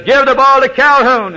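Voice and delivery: full-force high, loud acted yell; acted-out coach at the end of his rope; coach hollering. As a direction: holler the order at the top of your voice. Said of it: "and the coach leaned over".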